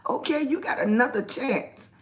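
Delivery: ordinary talk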